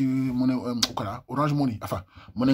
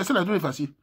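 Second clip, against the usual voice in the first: normal talking voice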